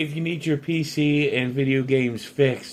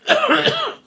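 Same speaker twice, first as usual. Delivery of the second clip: harsh hacking cough; smoker's cough; 5 dB louder than usual. Real, not acted. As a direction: cough hard, chesty and rough. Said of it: "it's my smoker's cough".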